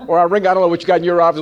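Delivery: ordinary talk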